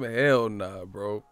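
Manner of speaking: usual speaking voice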